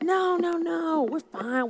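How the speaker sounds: high, quick no-no-no, waving help away; mock the volunteer who insists they're fine; eager servant brush-off. Imitating a volunteer brushing off the leader's offer, high and eager. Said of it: "the people that's like".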